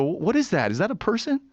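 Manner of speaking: high-pitched startled question, retold; puzzled surprise. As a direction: act out spotting something baffling. Retold startled reaction to a sighting.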